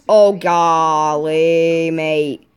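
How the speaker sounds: very slow, stretched-out exclamation; drawn-out mock dismay. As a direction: drag out the vowels in playful dismay. Mock dismay at enemy attack.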